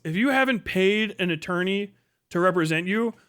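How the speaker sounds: ordinary talk, nothing marked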